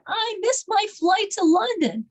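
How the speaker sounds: high-pitched imitated complaint; upset, retold. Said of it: "she was so upset".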